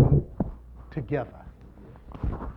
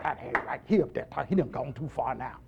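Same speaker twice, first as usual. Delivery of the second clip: low, quiet imitation of grumblers; muttered disapproval; 7 semitones lower, 10 dB quieter than usual. Preacher imitating people who think he went too far, low mock grumble. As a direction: mimic critics complaining under their breath. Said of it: "Some, some people are like".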